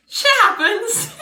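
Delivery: high, loud, drawn-out squeal tipping into a laugh; gleefully exaggerated, slow and high; mock cheerful. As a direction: sing it out with absurd cheer. Mocks the catchphrase, third time.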